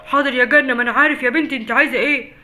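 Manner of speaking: exasperated shout. Acted. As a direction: snap at her, running late. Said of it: "انت بتزعق ليه".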